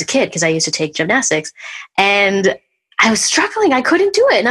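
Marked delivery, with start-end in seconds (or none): 3.0-4.4 pitched way up as if straining; straining high. Retold or imitated. Retelling her failed back bend, voice jumps high. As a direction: squeak it out like you can't lift yourself.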